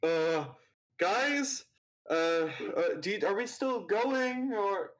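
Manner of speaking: nervous sidekick voice; timid assistant. Acted. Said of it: "the rickshaw and goes".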